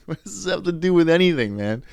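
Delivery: high, loud, fed-up what does this have to do with anything; snapping back at the older guy, exasperated; exasperated retort. Retold or imitated. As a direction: throw your hands up in words.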